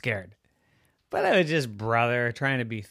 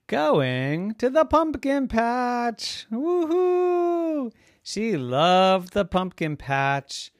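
excited narration; 12 semitones higher, 8 dB louder, much slower than usual. A narrator's reading.

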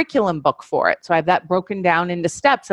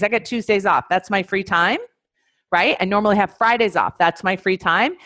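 ordinary talk

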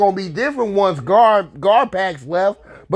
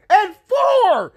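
disbelieving shout; 12 semitones higher than usual. Real, not acted. Fan shouting about the pick, high and outraged. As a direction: pitch way up, outraged.

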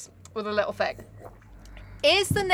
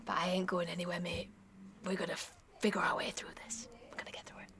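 whispered, much softer, gentle promise; reassuring whisper; 5 semitones lower, 19 dB quieter, faster than usual. Retold or imitated. Raven whispering to the grieving narrator that he stays. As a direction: lean in and whisper comfort.

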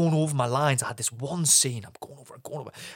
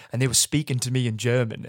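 ordinary delivery

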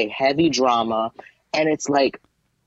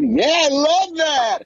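high, drawn-out squeal of approval; hammed-up, slow and very high delight; gushing mock enthusiasm; 13 semitones higher, 3 dB louder, much slower than usual. Imitating producers approving a silly idea, gushing and high.

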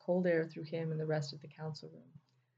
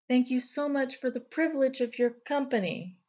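high, louder, dripping mock gratitude; sarcastic; 7 semitones higher, 7 dB louder than usual. A narrator's reading. Thunder sarcastically thanking Naomi as she leaves. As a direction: overdo the thanks to needle her. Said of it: "Thunder said sarcastically".

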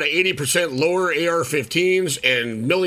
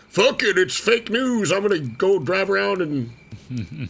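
higher-pitched mock defiance; mocking denier quote; 6 semitones higher, slower than usual. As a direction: mockingly act out a careless denier.

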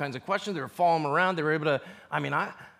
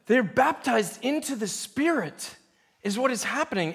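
ordinary talk, nothing marked

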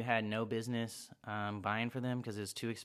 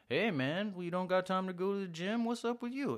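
teasing coworker; 9 semitones higher than usual. Pretend. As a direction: needle him like a gym-skipping office buddy. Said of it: "your coworker walks by with his coffee cup".

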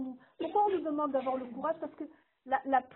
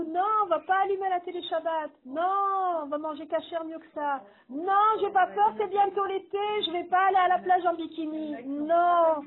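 defiant refusal; 3 semitones higher, 5 dB louder than usual. Acted. Defiant refusals voiced forcefully.

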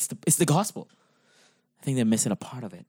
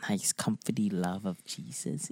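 whispered, cooing "comforty love of Jesus"; mock whisper; 3 semitones lower, 9 dB quieter than usual. Mocking the soft preaching: a cooing whisper. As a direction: whisper it like a sweet secret, mocking the soft gospel.

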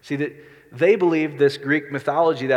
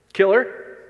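mock demand; 6 semitones higher, 6 dB louder than usual. Pharisees demanding the woman's death.

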